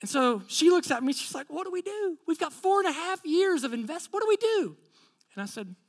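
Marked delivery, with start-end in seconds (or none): 1.5-4.8 high-pitched worried plea; retold anxious appeal, pitched up; imitated distress. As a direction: plead for help as the upset woman. Acts out distressed woman asking for help.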